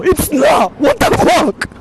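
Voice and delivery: real laughing indignation. Real, not acted. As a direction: shout the denial, rising with laughter. Protesting the claim loudly.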